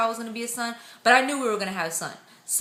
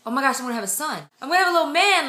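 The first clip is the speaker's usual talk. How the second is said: quickening retold thrill, rising louder and higher; real excited; 5 semitones higher, 5 dB louder, much faster than usual. Mother-to-be realizing she'll have a son, excited. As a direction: let the realization build into glee. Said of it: "I just got real excited".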